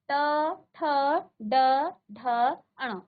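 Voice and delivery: ट ठ ड ढ अण each said apart with pauses, tongue at the roof; teacher spelling out the retroflex row; slow retroflex demo. Shown as a demonstration. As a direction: drill ट, ठ, ड, ढ, अण one at a time. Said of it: "पहले इसको जान लें".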